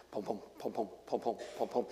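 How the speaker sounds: soft low thumping heartbeat; heartbeat sounds. Retold or imitated. Imitating a heartbeat.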